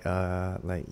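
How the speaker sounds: normal talking voice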